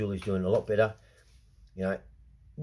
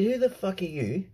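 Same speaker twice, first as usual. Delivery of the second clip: angry retold challenge; 6 semitones higher than usual. Confronting the man about his authority, angry. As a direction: square up to him with the question. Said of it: "And I said".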